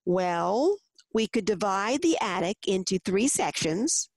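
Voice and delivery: usual speaking voice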